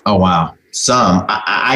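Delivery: ordinary delivery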